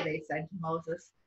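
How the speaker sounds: usual speaking voice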